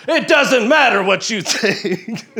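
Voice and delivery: booming high wrestler yell, slow and laughing; The Rock trash talk. Retold or imitated. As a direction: yell it like a wrestler calling someone out. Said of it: "gonna yell a little".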